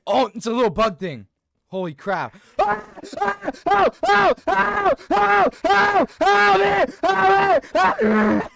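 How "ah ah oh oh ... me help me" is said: high frantic cries for help; panicked screaming. Real, not acted. Panicked, chased in the game.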